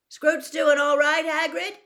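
high, loud call across a crowded room; a schoolboy hails his friend at the far end of the hall. Acted. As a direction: shout it over to the staff table. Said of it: "Harry called".

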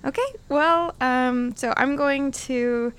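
ordinary delivery

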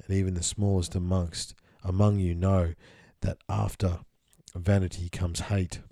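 measured, solemn reading voice; steady scripture-style reading; reading a channeled text. Acted. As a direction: read it out like old scripture. Reads channeled text aloud.

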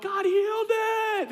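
put-on high, slow, joyful child's cry; kid's excited shout. Kids waking up, announcing to dad, excited shout. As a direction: shout it like a thrilled kid.

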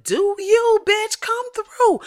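high, slow hyped-up cheer; slow cheering for Jada from the crowd; hype cheer. Acted. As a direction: cheer her on like a fan, stretching it out.

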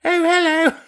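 high, loud mock Cockney greeting; Cockney accent. Shown as a demonstration. Co-host showing off his Cockney on request, hammed-up accent. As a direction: put on a squeaky-high Cockney hello. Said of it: "Can you do a Cockney accent?".

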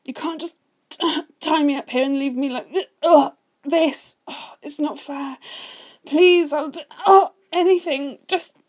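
high, loud, breaking pleas; desperate pleading. Acted. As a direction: beg for release, voice cracking. Bound character begging desperately.

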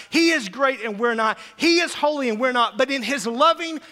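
loud, rising preacher's climax; preaching at full force. Acted. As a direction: preach it out to the whole church.

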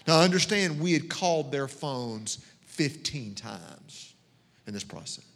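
normal talking voice

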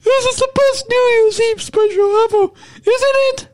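silly high-pitched insistence. Pretend. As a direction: keep hamming it up in a high voice. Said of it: "talk in my high voice".

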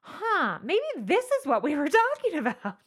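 high, surprised mock dawning; mock realization. Mocking those who preached doom, a surprised dawning. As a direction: play the sudden penny-drop, mocking.

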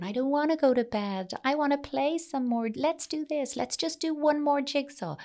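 put-on high, whiny child's voice, pitched well up; whining child. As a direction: whine it like a child who won't go to bed. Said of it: "even when they're whining, going".

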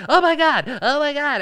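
a scandalized cartoon boy; mock Butters. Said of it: "And Butter's just like".

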